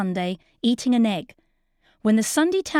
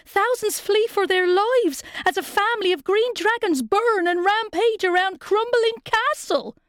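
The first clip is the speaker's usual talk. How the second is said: put-on high breathless young page; a panting page blurting news; out of breath; 10 semitones higher than usual. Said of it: "came panting up, coughed".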